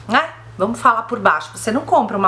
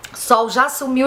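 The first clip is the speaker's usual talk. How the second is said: ordinary delivery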